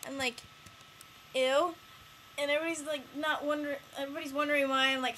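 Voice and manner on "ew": mock disgust. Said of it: "freaking out in class".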